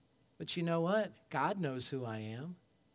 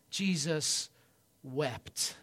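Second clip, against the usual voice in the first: ordinary talk, nothing marked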